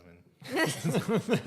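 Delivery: breaks into a loud, high laugh on the words. Real, not acted. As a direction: crack up as the joke lands. Laughing at his own joke.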